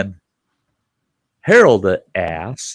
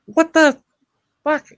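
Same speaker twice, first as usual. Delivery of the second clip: shocked outburst. Retold or imitated.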